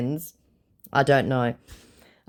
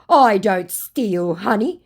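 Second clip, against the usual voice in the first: mocking scammer voice; 7 semitones higher, 6 dB louder, slower than usual. Reading the scammer's reply.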